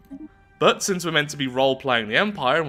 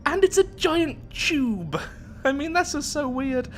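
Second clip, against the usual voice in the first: much higher, incredulous voice with a laugh; amused disbelief; 12 semitones higher, slower than usual. Real, not acted. Player marvels at a giant tube over the planet, amused disbelief. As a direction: pitch leaps up, baffled and amused. Amused by a bizarre alien object.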